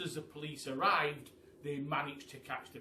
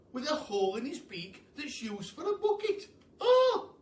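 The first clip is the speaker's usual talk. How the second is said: much higher, fast chattering voice, laughing and sobbing by the end; monkey fretting about Pelly's beak, much higher; worried monkey; 9 semitones higher, 6 dB louder than usual. A narrator's reading.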